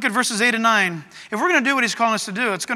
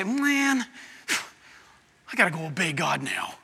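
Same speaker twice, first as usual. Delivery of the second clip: grudging sigh, then lower, sagging complaint; reluctant, put-upon; 4 semitones lower, 4 dB quieter than usual. Acted. Preacher mimics reluctant believer facing God's command, put-upon grumble. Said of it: "You don't talk like that".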